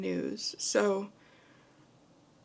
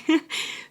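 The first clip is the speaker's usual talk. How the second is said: nervous laugh; 9 semitones higher, 7 dB louder than usual. Real, not acted. Nervous laugh after scary.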